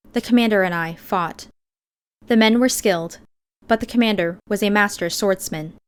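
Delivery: usual speaking voice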